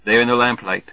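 ordinary delivery

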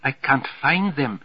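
put-on secretary's plaintive line in a German accent. A narrator's reading. Secretary who lost her glasses, German accent. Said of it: "German accent, all right".

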